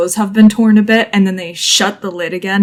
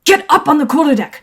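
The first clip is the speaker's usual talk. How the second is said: raised, higher-pitched command; called-out order; 7 semitones higher than usual. Acted. Hunter Garrick orders the group upstairs, urgent raised command. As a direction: order them up on deck.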